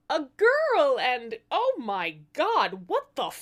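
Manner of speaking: high, loud horrified blurt, slowing down; shocked gasp. Acted. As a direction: recoil at the sight of the baby. Midwife sees the ugly baby.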